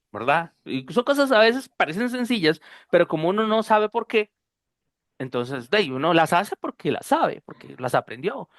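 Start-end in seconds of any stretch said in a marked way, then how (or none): none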